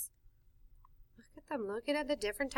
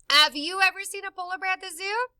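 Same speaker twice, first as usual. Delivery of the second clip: usual speaking voice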